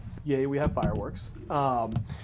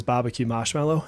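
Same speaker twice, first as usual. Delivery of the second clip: Boston accent with no R's in BARBECUE MARSHMALLOW; 2 semitones lower than usual. Shown as a demonstration. Showing the term chat requests, in Boston accent. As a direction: stretch the A's and drop the R's, Boston style. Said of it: "delete all the R's and extend the A's".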